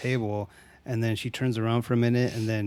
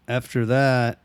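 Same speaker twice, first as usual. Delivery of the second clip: normal talking voice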